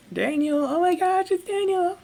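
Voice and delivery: squealing hysterical fan; fangirl shriek. Acted. Screaming fan again.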